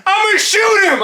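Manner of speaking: high, loud yelled threat; imitated farmer shouting. Farmer threatening to shoot the dog, high shouting. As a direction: holler it across a field.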